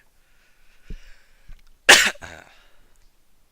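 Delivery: loud dry coughing fit; harsh cough. Real, not acted. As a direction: cough hard, clearing throat. Coughing while losing voice.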